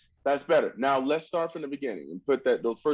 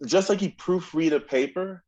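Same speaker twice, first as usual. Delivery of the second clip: ordinary talk, nothing marked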